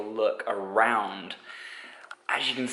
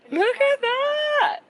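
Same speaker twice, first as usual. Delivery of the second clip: excited; 3 dB louder, much slower than usual. Real, not acted. Shows off rental car.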